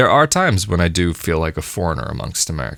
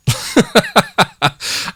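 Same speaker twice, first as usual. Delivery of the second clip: breathy laugh; 13 semitones higher than usual. Real, not acted. Laughs about April first.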